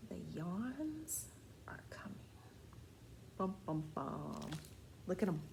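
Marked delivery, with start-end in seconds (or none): 0.1-2.2 whispered title. Shown as a demonstration. Title read hushed. 3.4-4.5 dramatic bum bum bum. Shown as a demonstration. Dramatic sound effect.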